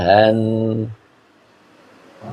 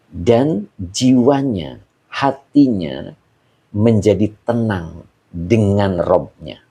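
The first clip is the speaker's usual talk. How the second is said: slow and deliberate; slower than usual. Shown as a demonstration. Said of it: "Perhatikan baik-baik tadi ya".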